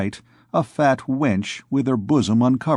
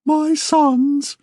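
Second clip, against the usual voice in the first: much higher falsetto Duke of Egypt, a little louder; 3 dB louder than usual. Acted. Duke of Egypt addressing his audience of thieves, in high falsetto. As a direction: lift into a reedy falsetto to address the crowd. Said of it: "in a falsetto voice".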